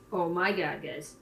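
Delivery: ordinary talk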